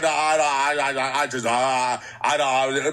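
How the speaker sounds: mock stuttering. Host mocking Munchie once police are outside, stuttering. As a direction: imitate a nervous man tripping over "I". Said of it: "You don't gotta stutter".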